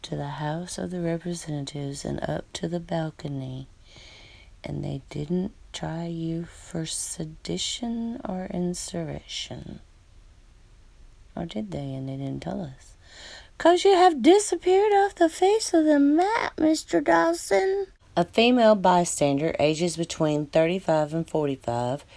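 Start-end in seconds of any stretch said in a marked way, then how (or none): 4.6-12.9 conspiratorial hush. Real, not acted. Suspicious aside about a cover-up. 13.6-17.9 mock dramatic falsetto. Dramatic taunt to the missing gunman.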